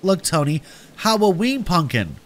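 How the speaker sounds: put-on small child eager to show something; Howie, excited. A narrator's reading. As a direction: pipe up like an excited little brother. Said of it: "jumping up and down excitedly".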